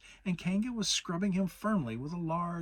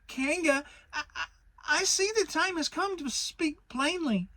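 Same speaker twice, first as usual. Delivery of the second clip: very high piglet voice, stammering bravely; tiny animal trying hard to sound brave; brave little voice; 5 dB louder than usual. A narrator's reading. Timid Piglet bracing himself to stand up to Kanga, high stammer. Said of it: "in as brave a voice as he could".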